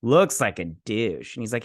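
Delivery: ordinary delivery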